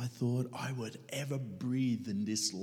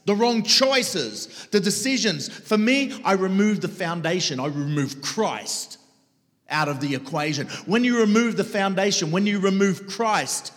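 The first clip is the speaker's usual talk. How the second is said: loud, higher, impassioned sermon voice; full-force preaching; 11 semitones higher, 11 dB louder than usual. Acted. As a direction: preach it hard to the crowd. Preacher drives the point home.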